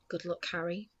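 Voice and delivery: soft low murmur. Acted. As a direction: say it gently under your breath. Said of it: "he murmured".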